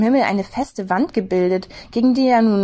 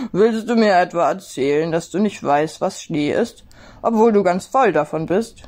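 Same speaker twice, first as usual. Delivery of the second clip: playful amused snowman; teasing snowman. A narrator's reading. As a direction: tease the rabbit good-naturedly. Snowman teases.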